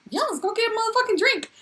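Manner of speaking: high, fast excited yes; hammed-up quick, high enthusiasm; eager acceptance. Acts out her eager acceptance.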